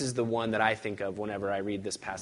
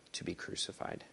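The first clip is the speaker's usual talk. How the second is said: whispered; 13 dB quieter than usual. Acted. Solemn whispered end.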